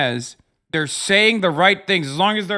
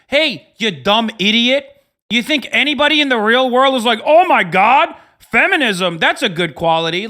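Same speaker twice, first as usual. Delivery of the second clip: loud, rising exasperated rant; yelling at online critics in exasperation; ranting shout; 5 semitones higher, 6 dB louder than usual. Real, not acted. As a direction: shout them down in disbelief.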